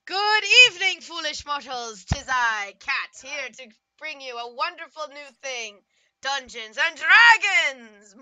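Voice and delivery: booming sinister host, Dungeons and Dragons drawn out slowly; a hammy evil overlord welcoming mortals; dark lord. Acted. Villain host greeting, foolish mortals.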